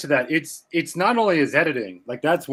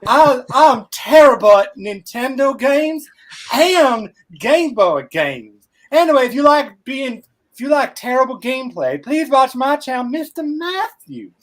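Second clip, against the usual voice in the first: mock impression of Matthews; 8 semitones higher, 5 dB louder than usual. S1 imitates Matthews for the hosts, hammed-up and high. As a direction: play him as a clueless bad gamer. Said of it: "my killer impression of you".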